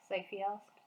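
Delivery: plain reading voice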